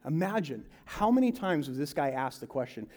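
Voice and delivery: normal talking voice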